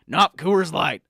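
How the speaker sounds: blustery talk-radio conspiracy crank; conspiracy pundit rant. Acted. Pundit badgering the host Sam about Gatorade, blustery rant. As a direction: rant like a paranoid supplement salesman.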